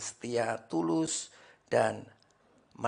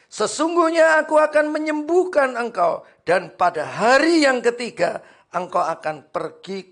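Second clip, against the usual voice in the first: loud, high scripture reading; full-force preaching; 11 semitones higher, 12 dB louder than usual. Acted. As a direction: proclaim it to the congregation. (membaca ayat dengan suara khotbah lantang)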